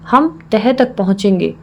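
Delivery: ordinary delivery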